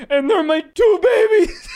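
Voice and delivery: mock tearful wail, high and loud; hammed-up sobbing shout through laughter; crying. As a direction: imitate a grown man bawling, slow and high. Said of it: "starts to cry".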